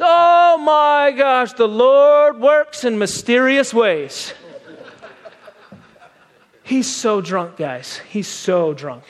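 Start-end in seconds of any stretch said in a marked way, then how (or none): 0.0-4.3 loud, higher, slow exclamation; drunken shout. Retold or imitated. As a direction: bellow it with drunken amazement. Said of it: "He says".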